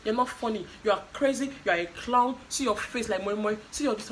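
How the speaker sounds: loud, high mock ranting; hammed-up indignant name-calling; heated insults. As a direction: fling childish insults one after another. Mimicking childish string of insults.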